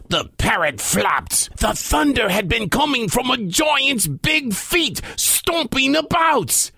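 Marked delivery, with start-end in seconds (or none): none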